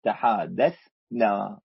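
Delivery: تحادثنا stretched out slowly, syllable by syllable. Shown as a demonstration. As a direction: draw out تحادثنا so every sound is heard. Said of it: "إذا قلنا تحادثنا".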